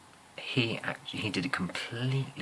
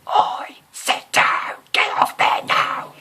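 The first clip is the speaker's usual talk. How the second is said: very loud, slow, shrill yell; music teacher yelling at pupils to sit down; shrill shout; 14 dB louder, slower than usual. Retold or imitated. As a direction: bellow at the kids like a shrill teacher. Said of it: "the most shrill yet humorous voice".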